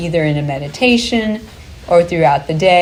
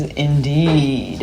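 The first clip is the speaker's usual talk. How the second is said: weird deep silly voice; 4 semitones lower than usual. Retold or imitated. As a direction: imitate a man's low, silly voice. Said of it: "this really weird voice like that".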